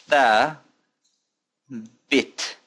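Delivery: THA held long, pause, then BIT; syllable by syllable. Shown as a demonstration. Teacher asking learners to pronounce along, slow split syllables. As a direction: break it up for repeating, THA ... BIT.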